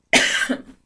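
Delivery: dry cough; a short real cough. Real, not acted. Cough before scratchy throat.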